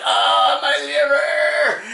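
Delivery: high, loud, stretched-out mock scream; agonized wail. Bystander hit by a flying fender, agonized wail. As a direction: wail it way up high in hammed-up agony.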